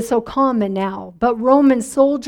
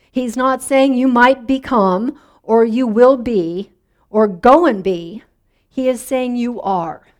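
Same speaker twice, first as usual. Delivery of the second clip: normal talking voice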